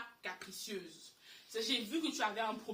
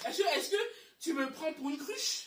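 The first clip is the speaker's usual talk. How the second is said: outraged question; 6 semitones higher, 6 dB louder than usual. Retold or imitated.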